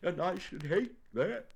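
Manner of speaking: high, plaintive bear voice; slightly sniffly. A narrator's reading. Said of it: "sniffing slightly".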